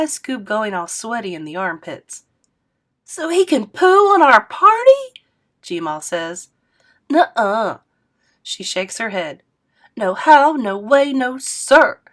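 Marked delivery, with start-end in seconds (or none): 3.0-5.2 much higher, louder outraged granny; indignant squeal. Acted. Grandma refusing to let Dad spoil the trip, squealing. 7.1-12.0 stamp out each no; emphatic refusal. Acted. Grandma refusing to answer Dad, loud and emphatic.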